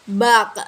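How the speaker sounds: "bak" ending in a bouncing released k; slow "bak" with a stop that echoes; bouncing final k. Shown as a demonstration. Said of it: "Dia tuh harus mantul".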